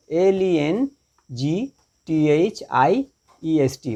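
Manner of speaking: very slow, separated letters; spelling out. Shown as a demonstration. Teacher spells the superlative for students, very slowly letter by letter. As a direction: dictate it letter by letter.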